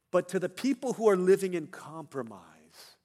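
forceful preaching. Real, not acted. Preacher turning to those living in compromise, forceful. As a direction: project it out to the whole congregation.

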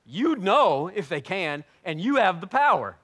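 loud, slow declaration; loud slow fervent sermon line; fervent preaching. Acted. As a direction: proclaim God's power. Preaching at full force.

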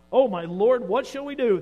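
alarmed cry. A narrator's reading. Servant panics seeing the army.

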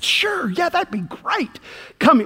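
high, lightly voiced sweet boss lady; a hint of a kindly Southern lady. Miss Pat welcoming the new hire, lightly voiced.

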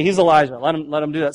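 usual speaking voice

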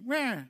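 mock toddler wail; mock wah. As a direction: imitate a toddler's whine. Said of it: "Don't just do".

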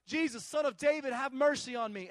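loud, high cry of the blind beggar; imitated shouting. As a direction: call out over the crowd, pleading. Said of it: "he shouts all the louder".

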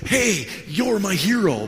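high, loud, drawn-out acclaim; showy cheering. Retold or imitated. Pastor imagines praising planter before the boaster, showy. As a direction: proclaim it for all to hear.

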